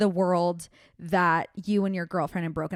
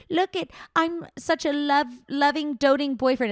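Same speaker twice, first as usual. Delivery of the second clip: mocking the boyfriend; 6 semitones higher than usual. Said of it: "trying to portray to everyone".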